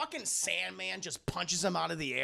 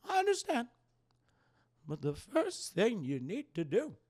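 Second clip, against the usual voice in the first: Aunt May voice; 3 semitones higher, 4 dB louder than usual. Retold or imitated. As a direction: swell it like a wise old aunt. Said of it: "That's my Aunt May voice".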